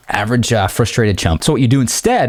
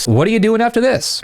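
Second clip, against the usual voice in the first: high, light, offhand question; breezy out-of-nowhere ask; nonchalant question; 12 semitones higher than usual. Shown as a demonstration. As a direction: toss it in casually, pitched up. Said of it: "nonchalant question".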